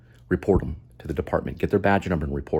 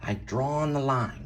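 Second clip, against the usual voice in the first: high, slow, put-on officer voice, each word laid down; mock stern officer; 6 semitones higher, slower than usual. Commentator mimicking a cop's mindset, high and slow. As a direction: play a cop digging in his heels, slow and high.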